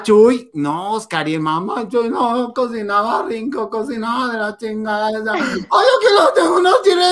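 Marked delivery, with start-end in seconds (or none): 5.3-7.0 high, loud whining mock sob; mock whiny lady. Imitating Mamá Chuy, loud mock wail. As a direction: wail it like a fake crying old lady.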